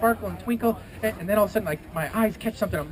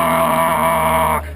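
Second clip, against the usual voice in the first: long, loud roar; big sustained acted "raah"; mad griffin roar; 3 semitones lower, 8 dB louder than usual. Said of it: "he gets really mad. He's like".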